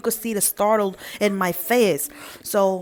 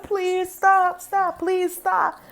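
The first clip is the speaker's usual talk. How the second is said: high, slow pleading cry of stop; desperate plea to stop car; 10 semitones higher, much slower than usual. Retold or imitated. Mother waving down a passing car, high desperate pleading. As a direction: flag the car down, begging it to stop. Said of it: "I start waving like".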